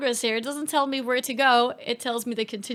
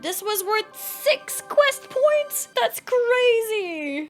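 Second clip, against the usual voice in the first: slow, high, near-tearful delight; real happy disbelief, drawn out; overjoyed disbelief; 9 semitones higher, much slower than usual. Real, not acted. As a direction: gush over the reward almost crying. Huge reward for a quest.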